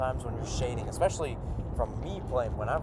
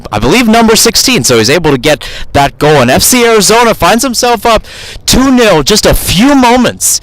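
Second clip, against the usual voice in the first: high, loud, excited goal shout; goal call; 7 semitones higher, 20 dB louder than usual. Real, not acted. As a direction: erupt as the header goes in. Second goal scored live.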